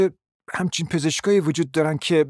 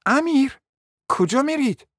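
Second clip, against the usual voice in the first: surprised cry; 7 semitones higher than usual. Acted. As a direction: call out in alarm as he walks off. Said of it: "با تعجب فریاد زد".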